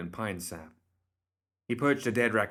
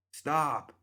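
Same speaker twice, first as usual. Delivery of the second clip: higher, sharp command; sharp order; 7 semitones higher than usual. A narrator's reading. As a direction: stop them at once. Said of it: "Crockett ordered".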